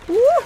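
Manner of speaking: a sudden high loud yelp; loud startled yelp. Real, not acted. Gamer yelping at a big hit mid-fight. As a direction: yelp as the blast lands.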